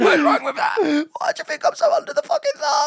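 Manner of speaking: put-on drunk mate. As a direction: squeal it like a pub lad defending his pints. Friend voices the drunk mate.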